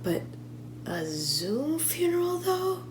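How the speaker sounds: slow, soft question climbing very high; drawn-out, hushed, rising disbelief; incredulous. Acted. Disbelief at a Zoom funeral.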